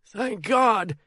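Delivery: high, thin frightened whimper; terrified old man whimpering. A narrator's reading. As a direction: whimper it out in relief. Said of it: "Sonny almost whimpers".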